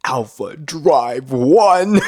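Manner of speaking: slow, drawn-out "Alpha Drive One", every word overdone; mock-grand, stretched delivery of Alpha Drive One; exaggerated enunciation. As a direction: imitate someone carefully announcing Alpha Drive One. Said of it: "One Star had to enunciate".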